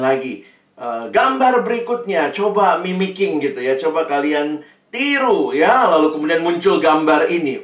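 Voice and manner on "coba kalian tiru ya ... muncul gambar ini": ordinary talk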